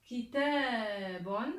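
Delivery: very slow, drawn-out model word; lecture appuyée. Shown as a demonstration. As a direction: stretch the long vowel in Kitabun. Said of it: "Je vais lire les mots". (lecture lente et appuyée)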